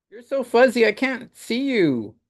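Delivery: put-on high little-boy voice, earnest and promising; a small boy reassuring his friend; child voice. Child Franklin voiced from comic.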